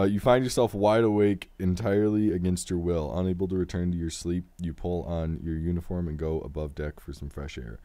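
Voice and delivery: animated read-aloud, strong openings easing down; story narration. A narrator's reading. Game host reading the next story beat to the player, story narration. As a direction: narrate the tale with clear projection.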